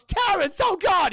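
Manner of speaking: very high, loud yell of horror; panicked scream. Retold or imitated. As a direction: scream his friend's name as he's shot. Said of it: "I think Philip goes".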